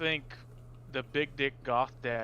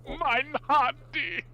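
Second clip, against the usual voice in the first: slow, high mock German exclamation; German accent; 10 semitones higher, 4 dB louder, much slower than usual. Echoing the German voice.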